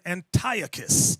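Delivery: Antiochus said an-TIE-uh-kus; alternative pronunciation. Shown as a demonstration. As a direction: stress TIE in Antiochus, ending in a hard kus. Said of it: "Some people pronounce his name".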